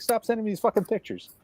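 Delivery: high-pitched mock complaint; put-upon whine, played for comedy; retold exasperation. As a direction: re-enact being fed up, pitch way up. Retelling own annoyed reply to friend.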